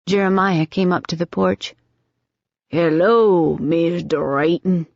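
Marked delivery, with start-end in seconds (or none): 2.7-4.9 put-on slow, refined old Southern greeting, R's swallowed; genteel Southern accent, unhurried. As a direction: greet him like a Virginia plantation gentleman. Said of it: "His accent was the old Southern, slow, refined, swallowing his R's".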